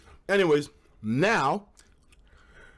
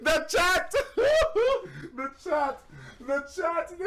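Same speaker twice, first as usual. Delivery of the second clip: high, giggly retelling of the chat; squealing with laughter over the chat; laughing mock quote; 10 semitones higher, slower than usual. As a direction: quote them while cracking up. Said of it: "people are saying".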